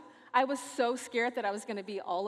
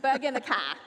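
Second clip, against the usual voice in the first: louder mock Australian accent; attempted Aussie accent; 6 dB louder, faster than usual. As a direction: try the line in an Aussie accent. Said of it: "I don't know how to do an Aussie accent".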